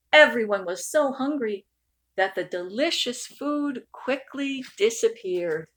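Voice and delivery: usual speaking voice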